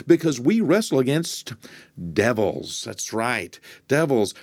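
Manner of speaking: fervent preaching. Acted. Preacher warning listeners their problem is the devil, fervent. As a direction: preach it, rising with conviction.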